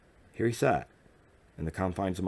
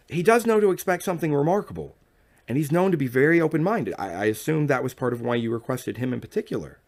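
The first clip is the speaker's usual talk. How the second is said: higher and louder, playfully chiming; woman playfully reassuring her guest, higher and louder; playful chime; 9 semitones higher, 6 dB louder than usual. Acted. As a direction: coax him with an innocent lilt. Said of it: "I chimed while twisting in my chair playfully".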